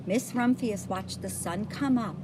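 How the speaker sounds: usual speaking voice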